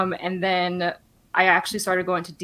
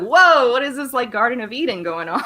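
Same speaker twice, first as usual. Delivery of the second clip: higher, louder mock wow, easing down; retold amazement; 4 semitones higher, 4 dB louder than usual. New patient marveling at the nonprofit hospital, playfully amazed. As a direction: act out being wowed, ending near a laugh. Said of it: "I was just like".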